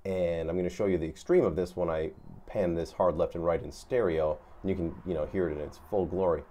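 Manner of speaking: ordinary talk, nothing marked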